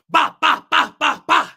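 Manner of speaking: loud, staccato "ba" bursts; high, percussive imitated noise; rapid vocal bangs. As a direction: fire off punchy mouth-made bangs. Imitated noise after the shout.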